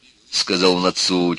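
plain reading voice

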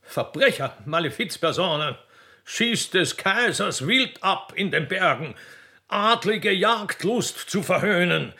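high, pompous official scolding the poachers; indignant accusation. Acted. Gamekeeper berating poachers, high and indignant. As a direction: thunder out the charge like a puffed-up gamekeeper.

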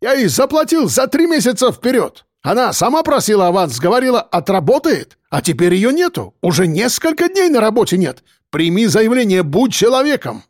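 high-pitched, agitated complainant; excitable character voice, indignant; indignant Caucasian man. A narrator's reading. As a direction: press the complaint in a high, heated voice. Voices Akbar pleading his case.

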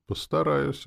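high, light reply; wry amusement. A narrator's reading. Man replying to driver's veiled threat, wry. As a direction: answer with a slight smirk. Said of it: "усмехнулся я".

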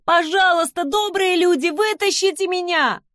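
high, wailing plea for rescue; loud pleading. A narrator's reading. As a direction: beg at full voice, pitched high. Victim begs to be pulled out.

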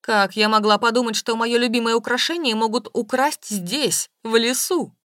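loud, indignant distress; upset, defensive. A narrator's reading. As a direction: protest your innocence loudly. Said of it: "Стала оправдываться весьма огорчённая дамочка".

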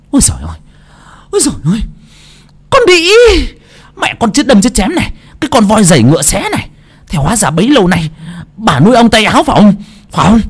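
high, fast scolding tirade; enraged Mụ Dương. A narrator's reading. Daughter-in-law catching maid and mother-in-law, enraged. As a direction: burst out cursing, loud and high. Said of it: "Mụ Dương mặt đỏ tía tai".

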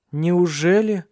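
high, sceptical retort; bitter. A narrator's reading. Ursula doubting Cecilia's defence of Alexander, bitter. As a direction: throw the question back bitterly. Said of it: "с горечью произнесла Урсула".

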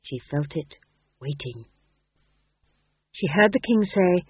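plain reading voice

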